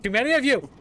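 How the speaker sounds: high, loud playful wail; mock dramatic yell. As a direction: cry out in mock despair.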